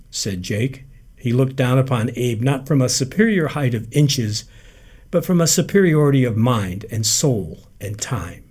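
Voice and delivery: ordinary delivery, nothing marked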